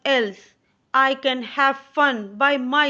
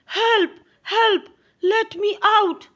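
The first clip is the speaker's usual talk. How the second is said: high, loud cries for help; panicked shout; 11 semitones higher, 5 dB louder than usual. Acted. John locked in his room, shouting for help in panic. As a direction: yell it out, trapped and scared. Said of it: "He panicked and shouted".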